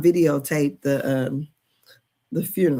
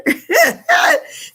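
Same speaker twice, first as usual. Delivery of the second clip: high wailing laugh that breaks into a cry; 8 dB louder than usual. Real, not acted. Laughing at herself to her viewers, crying laugh.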